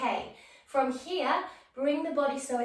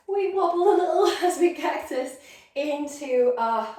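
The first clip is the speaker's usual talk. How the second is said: laughing while wobbling; 4 semitones higher, 8 dB louder than usual. Real, not acted. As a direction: chuckle through the words, a bit louder.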